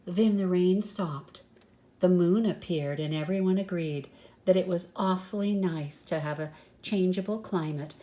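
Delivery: usual speaking voice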